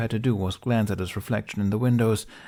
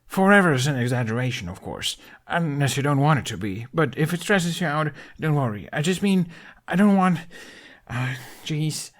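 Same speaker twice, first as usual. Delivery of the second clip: fast, higher nervous rambling; frantic backpedal; 7 semitones higher than usual. A narrator's reading. Hiccup panicking as Jack pulls away, rambling fast. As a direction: scramble to take it back. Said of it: "hand spun frantic circles".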